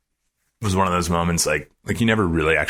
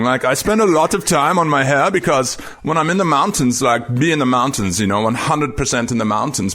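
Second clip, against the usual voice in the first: higher-pitched, rough imitation of a German-speaking climber's accent; Reinhold Messner's accent; 8 semitones higher than usual. Climber earnestly thanking a young fan for noticing his hair, imitated German accent. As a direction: do a famous mountaineer's German-tinged accent, pitched up. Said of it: "I'm gonna do his accent injustice".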